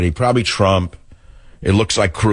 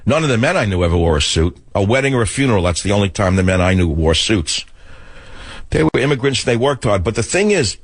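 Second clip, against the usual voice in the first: ordinary delivery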